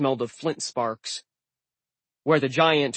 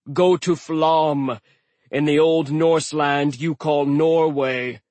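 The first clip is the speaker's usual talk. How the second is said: mocking giant; 2 semitones higher, 5 dB louder than usual. A narrator's reading. Dying giant gives directions.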